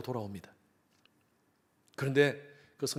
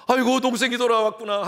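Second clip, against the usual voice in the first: glad "아이고", higher and louder; older son should greet his brother, higher and glad; joyful welcome; 9 semitones higher, 6 dB louder than usual. Pretend. How brother should rejoice.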